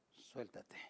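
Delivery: a hushed, fading call to let go; soft echo. Acted. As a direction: let the second one die away to almost nothing. God's voice, repeated call.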